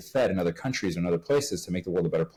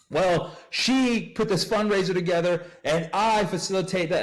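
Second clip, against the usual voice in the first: pitched way up, slow and smug, taking all the credit; mocking coworker voice; 9 semitones higher than usual. Retelling how coworkers claimed credit, smug mocking voice. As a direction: parody the two managers bragging to the boss.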